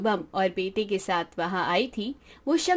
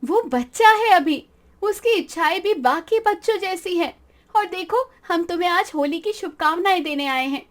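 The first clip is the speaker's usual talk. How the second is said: higher, louder firm reproach easing; higher voice reasoning with the father; firm but kind; 8 semitones higher, 4 dB louder than usual. Acted. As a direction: defend the child, then soften. Kashvi calms then greets.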